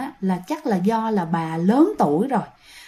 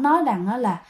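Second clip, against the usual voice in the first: usual speaking voice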